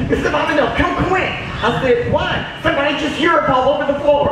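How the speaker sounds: put-on high, breathless kid alarm; boy rushing to tell the teacher; urgent child report. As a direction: burst in like a panicked little kid.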